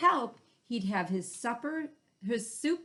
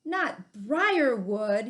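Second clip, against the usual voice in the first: higher, louder yelp, the second half slow; 5 semitones higher, 7 dB louder, slower than usual. Acted. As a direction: yelp in pain at the thorns. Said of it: "he yelped".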